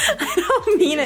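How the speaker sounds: high, giggly backpedal; flustered, laughing squeal of denial; laughing protest. Real, not acted. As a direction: apologize through laughter. Laughing apology to teased dad.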